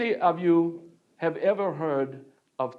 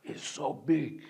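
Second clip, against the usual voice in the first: awed gasp; 9 dB quieter than usual. Acted. Awed at Notre Dame.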